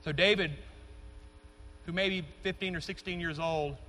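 ordinary delivery

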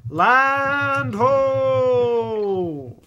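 high, loud lookout call; shouting from crow's nest. Acted. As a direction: call it out from the mast.

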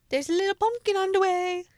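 high, singsong slogan; playful cute singsong; cutesy theme announcement. Pretend. Announcing cutesy baby shower theme.

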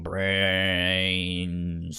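a light, drawn-out zombie groan; slow zombie moan. Retold or imitated. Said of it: "a really slow speech of just, like".

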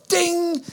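high, sung-out quiz bell; imitated bell ding. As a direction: chime it like a correct-answer bell. Imitated bell for right answer.

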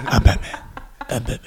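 a hint of Batman's whisper. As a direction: breathe it out like the dark knight. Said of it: "No, no, no, it's".

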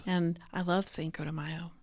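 very soft, low-key whisper; whispered aside. Real, not acted. Wife confiding her love of the holiday, whispering. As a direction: whisper it as a little confession.

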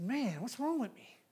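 soft, slow self-reproach; quiet, slow inner lament; muttered to himself. Retold or imitated. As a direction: mutter it under your breath in dismay. Self-talk quoted in sermon.

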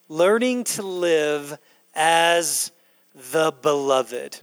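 slowly, each word weighed; slow emphatic repeat. Shown as a demonstration. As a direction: let the phrase sink in slowly. Pastor repeats key phrase for emphasis.

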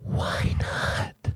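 hushed, voiceless mock hurt; acted helpless whisper; whispered question. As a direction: whisper it, drawn out. Acting his wounded reply.

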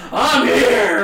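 loud, high mock arrival holler; bursting-in shout. Late comedian barrels through the door, shouting to the host. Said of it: "he comes barreling through the door".

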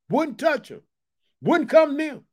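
usual speaking voice

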